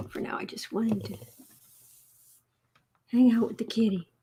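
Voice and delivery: unvoiced hushed whisper; soft whisper. Real, not acted. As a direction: whisper so the cat stays calm. Whispering beside sleeping cat.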